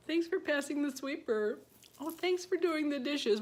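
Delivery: high-pitched appreciative thanks; complimenting wife. Retold or imitated. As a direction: praise a husband for his chores, voice lifted. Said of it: "guys really like to be complimented".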